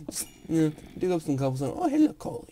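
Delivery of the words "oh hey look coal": high, loud, thrilled oh hey look; delighted squeal at a discovery; excited find. Real, not acted. Gamer finding coal, high and excited.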